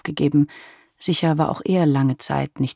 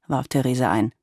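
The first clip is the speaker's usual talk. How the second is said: plain reading voice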